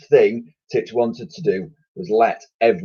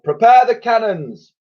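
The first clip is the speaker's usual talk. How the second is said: put-on high commanding old admiral; admiral giving orders; 13 semitones higher, 6 dB louder than usual. A narrator's reading. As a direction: bark out the order.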